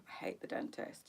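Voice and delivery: breathy whisper. Real, not acted. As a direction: whisper the dread. Dentist dread, said then repeated.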